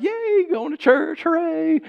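high, drawn-out mock hooray; singsong glee, hammed up; gleeful cheering. Retold glee going to church.

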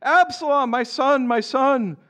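high-pitched acted lament; crying out in grief. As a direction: read as a grieving king's cry, no sobs. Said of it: "cried loudly".

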